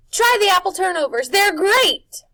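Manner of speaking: put-on a boy bursting with delight; Wendell voice. A narrator's reading. Wendell urges Harvey to try the turnovers, high and eager.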